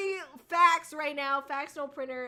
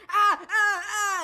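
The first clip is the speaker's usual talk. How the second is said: pained ah ah ah; 7 semitones higher, 10 dB louder, much slower than usual. Retold or imitated. Mimicking character's pained cry.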